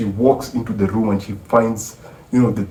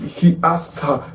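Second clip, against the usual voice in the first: ordinary talk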